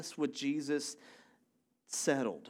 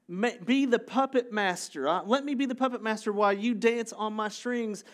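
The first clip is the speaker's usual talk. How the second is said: mock demanding; 6 semitones higher than usual. Acting out people wanting to control Jesus.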